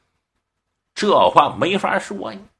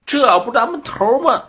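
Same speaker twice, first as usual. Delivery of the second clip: high-pitched startled question; acted astonishment, pitched up; surprised soldier; 9 semitones higher than usual. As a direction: gape in disbelief at your chief. Soldiers startled to see their chief crawling.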